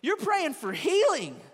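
impassioned preaching. Real, not acted. Preacher pleading for those who pray for healing, impassioned and loud. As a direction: preach it with full force, stretching key words.

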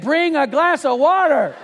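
put-on loud, high, drawn-out child's call; little daughter. Daughter calling for water despite threat, high drawn-out call. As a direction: call out like an undaunted little girl. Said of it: "she said".

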